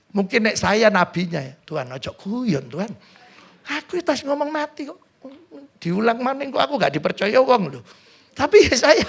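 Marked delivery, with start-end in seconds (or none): 1.6-7.8 high, soft mock complaint; mock grumbling prophet. Preacher imagines complaining to God as the prophet, higher and soft.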